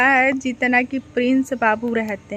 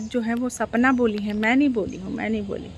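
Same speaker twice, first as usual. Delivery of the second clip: quiet disclaimer; 3 semitones lower, 6 dB quieter, faster than usual. Real, not acted. Disowning what Sapna said about the children, quiet disclaimer. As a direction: lower and softer, fading away. Disclaiming another person's insult.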